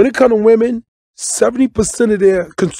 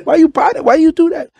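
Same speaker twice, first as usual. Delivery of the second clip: critics mocking; 7 semitones higher than usual. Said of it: "sometimes people say".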